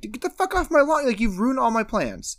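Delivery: high, mock-furious yell at trespassers; angry gardener. Gardener yelling at Scooby over his ruined lawn, mock angry high. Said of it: "pissed, as I would be".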